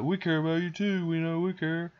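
lightly voiced, high, sincere reassurance; pleading forest animals. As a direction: imitate cute creatures insisting they care.

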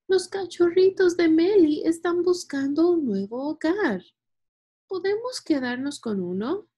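put-on high, eager kid's plea; excited storybook boy voice; eager child. A narrator's reading. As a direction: pitch it up like a child asking for a treat. Child begs parents for a puppy.

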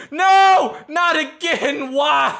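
high loud cry of despair; panicked scream. Real, not acted. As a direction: yell in real fright. Monster attacks again.

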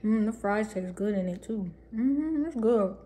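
muffled closed-lip speech; chewing while talking. Real, not acted. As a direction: mouth partly full. Seen 1: Bites fry at -2; lips keep working, closed while talking.

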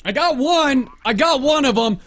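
high, loud triumphant call; excited. Real, not acted. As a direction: crow over the kill. Celebrating a kill in game.

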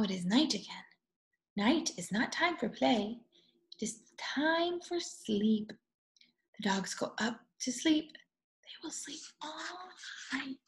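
quiet night voice; hushed whisper. A narrator's reading. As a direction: lull them toward sleep. Hushed bedtime section.

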